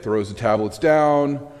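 ordinary delivery

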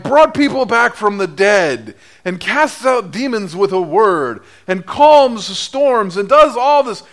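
ordinary talk, nothing marked

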